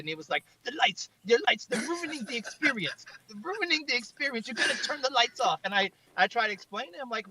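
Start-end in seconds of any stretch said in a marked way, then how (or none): 0.6-5.6 put-on a light French accent, a touch higher, fussing over the lights. Filmmaker imitating the French founder's accent, lightly. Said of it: "came up in his French accent".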